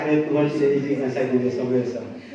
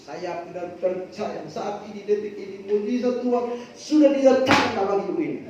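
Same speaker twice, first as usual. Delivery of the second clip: prayer building up; 5 semitones higher than usual. Retold or imitated.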